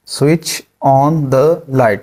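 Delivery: dragged out word by word; very slow. Shown as a demonstration. Teacher drills the command slowly. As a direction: stretch it out very slowly. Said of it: "light जलाओ".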